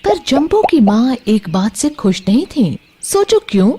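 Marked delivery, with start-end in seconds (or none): none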